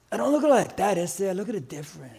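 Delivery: soft, low hedging churchgoer; mildly dodge, dropping low and quiet; a hint of an evasive believer. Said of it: "You know, the Christians".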